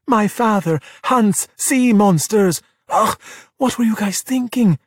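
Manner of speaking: high, drawn-out, emphatic warning; exasperated scolding. Acted. Giulia warns Luca of her father, exasperated. Girl scolds exposed sea monster.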